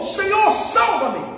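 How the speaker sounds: high, drawn-out cry for rescue; desperate shout. Acted. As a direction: shout it out as Peter going under. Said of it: "Aí ele gritou".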